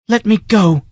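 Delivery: put-on high, pleading girl's voice; begging. A narrator's reading. Girl begging her captor, high and pleading. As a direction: beg him to release her. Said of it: "begged the girl".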